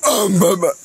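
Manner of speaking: strained, hoarse attempt at a masked hero; Batman. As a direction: have a rough go at the hero voice and give up. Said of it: "It sounds hoarse".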